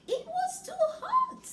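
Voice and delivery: high squeal of it was too hot; squeaky too hot. Acted. Reader to child, high squealed too hot. Goldilocks tastes hot porridge.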